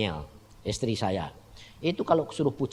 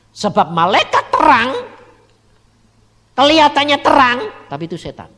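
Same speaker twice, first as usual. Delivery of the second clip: preaching; 13 semitones higher, 13 dB louder than usual. Acted. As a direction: drive the warning home from the pulpit. Preaching at full force. (khotbah lantang dan lambat)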